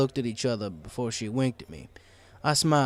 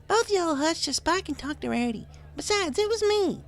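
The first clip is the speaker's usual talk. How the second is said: much higher, drawling little filly; high country filly. A narrator's reading. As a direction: hush them like a country kid. Third filly voice.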